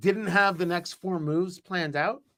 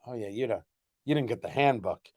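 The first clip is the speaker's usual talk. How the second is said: lower, knowing put-down of the newcomer; a local gently mocking the newcomer; condescending local; 8 semitones lower than usual. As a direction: talk down to the naive outsider. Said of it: "And then they go".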